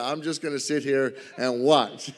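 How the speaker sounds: chuckling through the words; amused at his own overconfidence; laughing. Real, not acted. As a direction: laugh at yourself as you say it.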